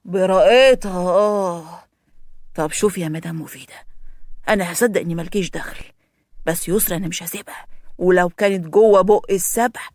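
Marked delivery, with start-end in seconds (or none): none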